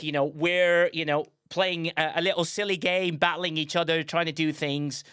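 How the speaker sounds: ordinary delivery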